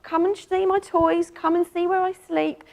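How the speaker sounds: high, bright-eyed cat asking questions; cat greeting a visitor at the door, high and eager; curious cat voice. Acted. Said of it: "she is the first cat on the scene".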